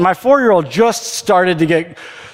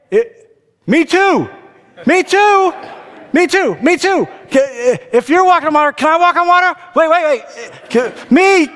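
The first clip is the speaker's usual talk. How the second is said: put-on much higher, pleading kid-like Peter; eager Peter; 9 semitones higher than usual. Peter begs Jesus to let him walk on water, high eager plea. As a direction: beg to join like an overexcited child. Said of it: "But for Peter, it was".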